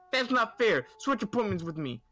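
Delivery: mock whiny demand. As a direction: demand it like a spoiled entitled mom. Entitled mother demands swap.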